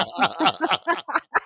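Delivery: high burst of laughing; laughter. Real, not acted. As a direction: crack up after mock quarrel. Both crack up after quarrel.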